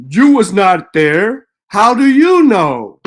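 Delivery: slow, loud mocking whine of a doubter; mocking the people who can't argue, loud and slow; mocking quote. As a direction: drag it out loud, mocking their line. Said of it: "my favorite line from everybody".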